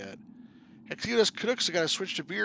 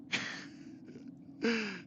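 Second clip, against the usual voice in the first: breathy laugh; 8 semitones higher, 6 dB quieter than usual. Real, not acted. Laughing at scene.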